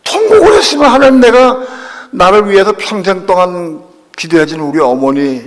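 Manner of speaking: loud, high plea to God; acted lament, bursting out then easing; retold wailing prayer. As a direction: cry out in re-enacted anguish. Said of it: "그 내가 울며 통곡을 해서".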